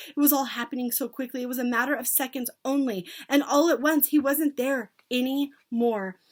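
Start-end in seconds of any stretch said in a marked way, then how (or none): none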